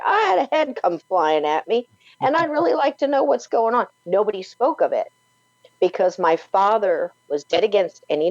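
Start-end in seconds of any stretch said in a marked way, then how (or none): 0.0-3.9 mock child answer.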